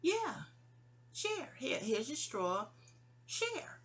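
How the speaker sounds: high, soft mock voice; little high voice for a retold line; acted-out retort. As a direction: act out the exchange high-pitched and lightly. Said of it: "I said".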